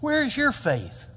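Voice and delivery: high, slow "Where is your faith?"; emphatic question. Acted. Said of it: "That's my emphasis".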